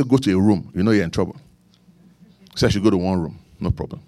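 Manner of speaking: normal talking voice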